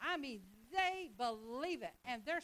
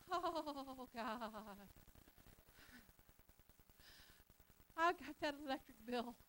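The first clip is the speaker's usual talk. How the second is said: mock humble whine; much slower than usual. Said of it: "we sound very humble".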